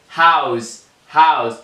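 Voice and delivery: "هاوس" begun with an H from the throat; teacher's demo of "هاوس", throaty initial H. Shown as a demonstration. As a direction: model "هاوس" with its H made in the throat.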